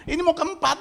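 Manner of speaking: begging to be allowed to bless; desperate pleading. Retold or imitated. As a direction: beg like you can't sleep. Man pleading, retold.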